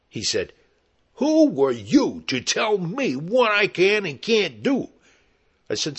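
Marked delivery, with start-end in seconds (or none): 1.1-4.9 slow, high, loud indignation; angry yelling. Retold or imitated. Hairy man challenging the cop voice, indignant yell. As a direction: yell it word by word, outraged. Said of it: "he's yelling at me".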